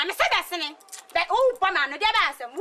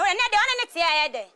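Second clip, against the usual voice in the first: normal talking voice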